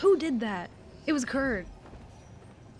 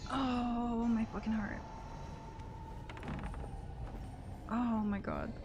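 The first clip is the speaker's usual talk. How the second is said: shaken; 10 dB quieter, slower than usual. Real, not acted. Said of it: "actually shaking".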